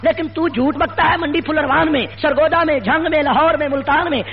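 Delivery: high-pitched, relentless rally oratory; fiery preaching. Real, not acted. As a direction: build accusation on accusation in rising, driving phrases. Preacher builds a fiery address. (جوشیلی، بلند سُر کی خطیبانہ للکار)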